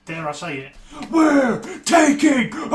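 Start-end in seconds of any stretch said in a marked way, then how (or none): 1.1-2.7 villain shout. Acted. Villainous podcast boast.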